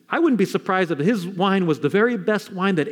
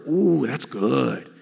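slow, lowered, drawn-out relish; savouring approval; 6 semitones lower, much slower than usual. Acted. Preacher acting wedding guests tasting wine, slow savouring approval.

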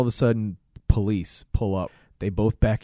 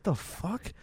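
muttered; 7 semitones higher, 10 dB quieter than usual. Retold or imitated. Guy's confused reaction.

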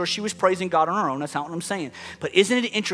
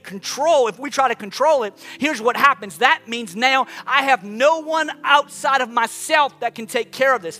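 raised, urgent preaching voice; impassioned preaching; 7 semitones higher, 5 dB louder than usual. Real, not acted. Preacher urging people to hand control to God, impassioned preaching. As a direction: preach it higher, pressing on without letting up.

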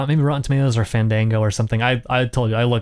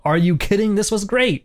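mock enthusiastic read; 8 semitones higher than usual. Reading a review title mockingly.

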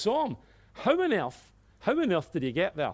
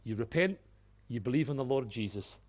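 normal talking voice

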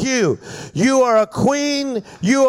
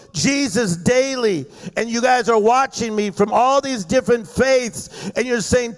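usual speaking voice

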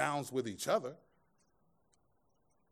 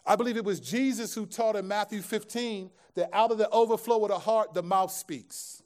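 preaching; 6 semitones higher, 6 dB louder than usual. Acted. Preaching at full force.

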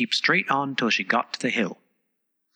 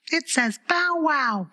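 very high, slow, shrill little flower; a tiny daisy crying out; squeaky daisy; slower than usual. A narrator's reading. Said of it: "cried a daisy".

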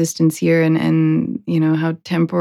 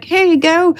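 lightly voiced higher customer; cart customer; 10 semitones higher than usual. Man handing a body to the cart, higher voice. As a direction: hand over the body, pitched up. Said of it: "he's like".

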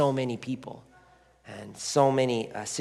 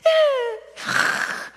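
very high, loud mock cry; imitated child's wail; 7 dB louder than usual. Child finally breaks down crying.

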